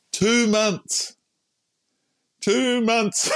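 TWO MONTHS pitched high and drawn out; exasperated emphasis. Real, not acted. Patient stressing how long he put up with pain, exasperated emphasis.

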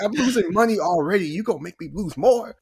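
high mock-panicked boss voice; a boss fretting over lost money; exasperated boss. Boss complaining about losses, high and exasperated.

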